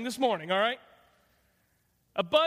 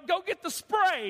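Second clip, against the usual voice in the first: mock order; 6 semitones higher, slower than usual. Wife ordering husband.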